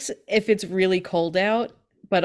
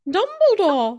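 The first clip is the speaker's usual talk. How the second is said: high, loud playful call; a hammed-up shout of the wizard's name; mock wizard name; 11 semitones higher, 8 dB louder, slower than usual. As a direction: sing out the name like a punchline. Answering Snape joke.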